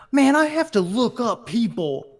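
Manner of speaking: put-on young lady complaining, stretched then rushed. Voicing other people's TikTok complaints, mock young lady. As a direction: do a whiny young-lady character voice. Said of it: "a young lady".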